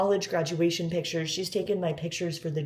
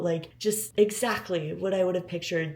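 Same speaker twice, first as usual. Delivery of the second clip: usual speaking voice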